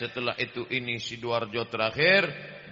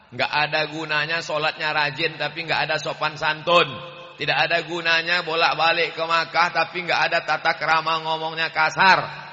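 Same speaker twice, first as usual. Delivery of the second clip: louder, pounding each phrase; sermon warning about manners, full force; forceful preaching; 4 semitones higher, 7 dB louder than usual. Real, not acted. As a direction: preach it hard to the crowd. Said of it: "Ustaz Somad itu ngomongnya kasar".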